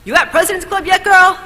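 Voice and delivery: high, loud teasing call of a friend on the phone; imitating Elio. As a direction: pitch it way up like a friend ribbing her. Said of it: "He always closed the call with".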